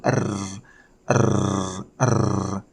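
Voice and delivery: "R" as a sustained tongue trill, repeated; rolled R. Shown as a demonstration. Teacher demonstrating the hard sound to learners, "R" as a long trill. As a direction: vibrate the tongue for each "R", no letter name. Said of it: "vibrate your tongue".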